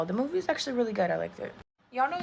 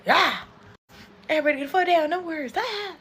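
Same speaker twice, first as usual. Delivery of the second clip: high, loud hammed-up panic shout; mock chaos yell; 8 semitones higher, 5 dB louder than usual. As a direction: yell like the brain's in total chaos. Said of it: "And now it's just like".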